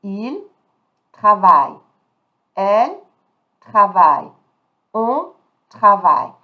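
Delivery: "travaille" loud and separated from each pronoun; silent final E. Shown as a demonstration. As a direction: drill each pronoun, pause, then a forceful "travaille" ending on "vai". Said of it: "I'm not pronouncing the E".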